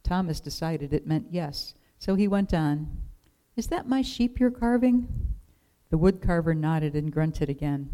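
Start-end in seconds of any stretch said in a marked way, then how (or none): 3.5-5.1 put-on high-pitched little boy's question; tentative, childlike query; curious child. A narrator's reading. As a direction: ask in a small, high child's voice. Boy timidly questions the grumpy woodcarver.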